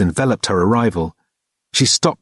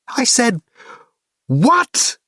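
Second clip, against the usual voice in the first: high, shouted demand repeated; yelled question; 13 semitones higher, much slower than usual. A narrator's reading. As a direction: yell it back at him, fed up. Kate yelling at figure.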